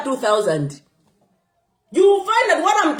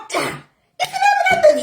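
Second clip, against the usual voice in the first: slow, much higher squeal; high-pitched; slower than usual. Real, not acted.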